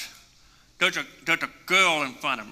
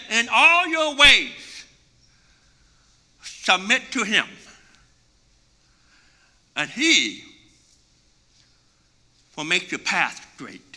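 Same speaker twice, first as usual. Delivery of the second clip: slow scripture; 3 semitones higher, slower than usual. Acted. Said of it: "He said".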